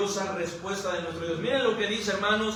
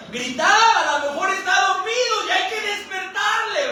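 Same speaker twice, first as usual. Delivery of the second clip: high, raised mocking call; mocking taunt; 9 semitones higher, 4 dB louder than usual. Elías mocks the prophets of Baal, high taunting shout. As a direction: jeer as if calling out to wake someone. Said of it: "Se empieza a mofar un poquito".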